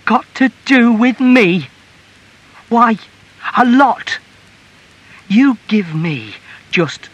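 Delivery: very high, loud, slow indignant tirade; outraged wife at full voice; furious outburst. A narrator's reading. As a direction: throw it back at him and build in fury. Wife erupts, echoing his words.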